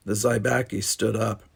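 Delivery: plain reading voice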